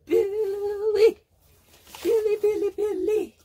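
squeaky high sung-out calling of Billy; high singsong calling. Pretend. Reading a card aloud, calling Billy in a squeaky singsong. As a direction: call the name in a tiny high voice, playfully.

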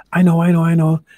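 loud, high, eager blurting; bursting to answer first; eager know-it-all. Acted. Alligator eager to answer Stork, loud and high. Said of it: "said Alligator".

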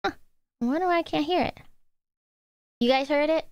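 ordinary delivery

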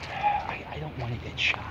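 scared whisper so no one hears; a nervous whisper in the woods. Real, not acted. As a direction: hush it, afraid of being caught. Scared of trespassing.

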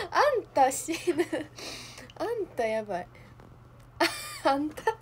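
high laughter around the words; cracking up. Real, not acted. Amused by the Kansai word, laughing hard. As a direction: crack up saying it.

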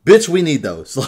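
loud, indignant demand; retold outrage. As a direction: act out being outraged at friends. Retold outrage over sold ingots.

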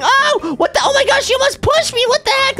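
high-pitched shocked squeal; hammed-up high outrage; startled protest. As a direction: squeal at a brother in alarm. Almost pushed off by brother.

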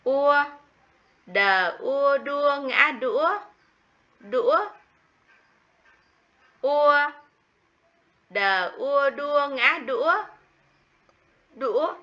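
Đờ ua đua ngã đũa spelled slowly and high, each syllable drawn out; slow spelling. Shown as a demonstration. Teacher models spelling đũa for the class, slow and high. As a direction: drag out each part of the spelling for little ones. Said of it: "Các con cùng đọc lại theo cô".

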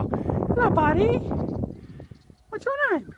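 very high, sweet pet voice. Real, not acted. Talking to the horse in a high baby voice.

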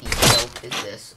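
ordinary talk

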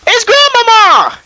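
high, drawn-out shocked cry; startled shriek. Real, not acted. Gamer spotting Granny in the dark, startled shriek. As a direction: shriek the discovery at the sight of her.

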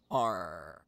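low gruff pirate arr; pirate growl. Acted. Pirate rules sign-off.

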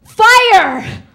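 loud, high-pitched eager yell; punchline shout. Acted. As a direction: shout it as a proud, mistaken alarm. Bush shouts the punchline word.

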